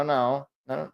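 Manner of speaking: ordinary talk, nothing marked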